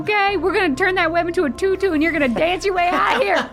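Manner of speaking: cooing up high to a pet; lightly babyish reassurance; high, fast baby talk. Acted. Comforting trapped cat.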